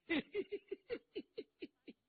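a gleeful high chuckle; chuckling laugh. A narrator's reading. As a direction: giggle with delight at the news. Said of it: "程咬金扑哧一乐".

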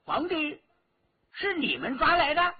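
high-pitched astonished query; startled question. A narrator's reading. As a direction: ask in amazement, pitch jumping up. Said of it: "那个老妇咦了一声". (惊讶地高声反问)

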